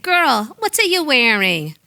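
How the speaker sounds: high-pitched Jamaican mother; heavy Jamaican accent. Acted. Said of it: "Mama Till's voice with her heavy Jamaican accent".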